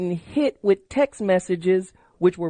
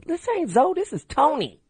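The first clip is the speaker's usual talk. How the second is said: put-on teen boy voice; 9 semitones higher than usual. Kid finally reveals his name.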